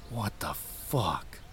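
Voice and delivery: slow, soft muttered curse; scared muttered curse. A narrator's reading. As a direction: breathe it out under your breath. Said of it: "I muttered".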